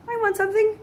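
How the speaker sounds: high, loud mock-childish demand; whining boys. Boys wanting an extra letter too, mock whine. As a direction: whine for a share like a jealous boy.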